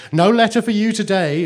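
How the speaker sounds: high, loud, brisk dismissal; busy postmistress. A narrator's reading. Busy postmistress to waiting children, brisk high dismissal. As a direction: call it out curtly, high and loud.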